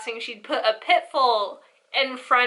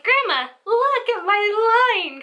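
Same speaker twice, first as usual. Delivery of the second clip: put-on excited child; 9 semitones higher, 3 dB louder than usual. Child showing off to grandma.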